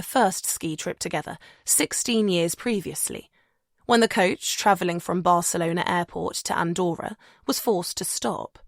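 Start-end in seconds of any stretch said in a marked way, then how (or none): none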